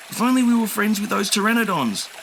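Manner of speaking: put-on high wistful kid voice; young dinosaur. A narrator's reading. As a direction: wish it out loud. Enki voice.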